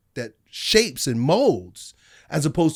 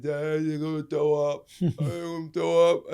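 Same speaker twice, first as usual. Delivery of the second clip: begging; 3 dB quieter, slower than usual. Retold or imitated. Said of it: "He was begging".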